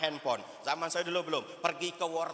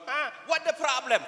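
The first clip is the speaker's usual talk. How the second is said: higher, surprised mock-English father voice; lightly voiced father asking what's wrong; father's reply; 10 semitones higher, 4 dB louder than usual. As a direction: play the puzzled dad, pitch up. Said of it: "kata bapak saya".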